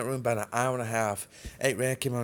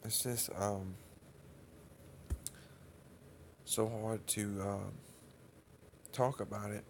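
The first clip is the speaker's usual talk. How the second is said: soft, halting grief; real tearing up; 6 dB quieter than usual. Real, not acted. Telling viewers it is hard to talk about, tearful and halting. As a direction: struggle to get the words out, quietly, with long pauses. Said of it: "I start tearing up 'cause it's painful".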